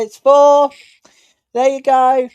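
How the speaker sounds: high, loud burst calling the goal. Real, not acted. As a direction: shout it as the ball goes in. Live goal call, fourth goal.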